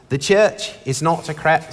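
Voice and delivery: badly faked Aussie accent; clumsy Australian accent, breaking into laughter. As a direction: attempt a poor Australian accent. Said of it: "It's the worst Australian accent ever".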